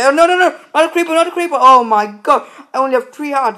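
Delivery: panicked. Real, not acted. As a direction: beg the creeper away. Creeper appears, low health.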